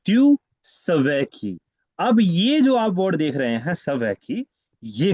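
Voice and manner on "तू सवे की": drawn-out, syllable by syllable; teacher's slow demonstration; slow read-out of the phrase. Shown as a demonstration. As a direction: read the French words slowly for the class. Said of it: "बहुत सारी चीजें थी जो हमें समझ नहीं आई".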